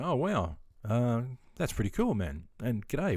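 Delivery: usual speaking voice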